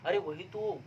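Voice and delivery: ordinary talk, nothing marked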